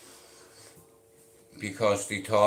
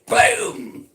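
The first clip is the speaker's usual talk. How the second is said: a sudden high, loud mock boom; explosion noise; 4 dB louder than usual. Telling how he got his nickname, shouts a high mock boom. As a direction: blurt out a bang like a bomb.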